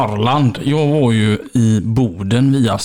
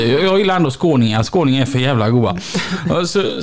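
ordinary talk

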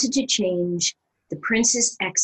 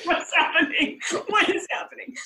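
panic; 9 semitones higher than usual. Retold or imitated. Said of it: "I'm feeling it coming apart".

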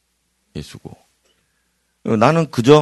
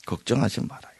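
ordinary delivery

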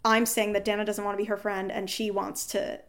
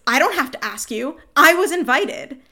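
rising, louder, slowing on I was invited; indignant retort; 5 semitones higher, 8 dB louder than usual. Retold or imitated. Partner snapping back at her, loud indignant mock retort. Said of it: "Her response is".

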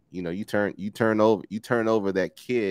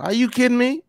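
high, loud disbelief; a high, loud, playful are-you-kidding-me; mock outrage; 13 semitones higher, 5 dB louder than usual. Real, not acted. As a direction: act stunned and offended. Mock outrage at the couch remark.

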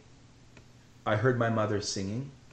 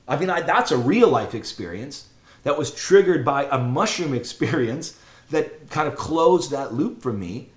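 ordinary talk, nothing marked

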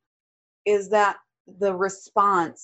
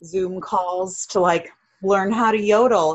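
ordinary talk

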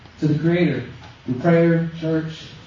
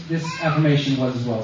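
ordinary delivery

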